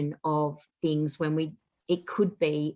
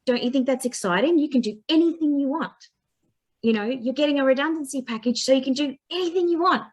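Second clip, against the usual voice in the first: upbeat encouragement; 7 semitones higher, faster than usual. Retold or imitated. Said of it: "And I was like".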